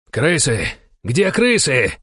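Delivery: high, urgent angry cry; furious shout. A narrator's reading. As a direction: yell it out in a rage. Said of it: "яростно закричал Денис Нестеров". (яростный крик)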